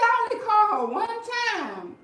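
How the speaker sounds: high, loud mocking whine; mocking husband. Quoting her husband's lame excuse, high mocking whine. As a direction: whine his excuse back at him, mocking. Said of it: "he said".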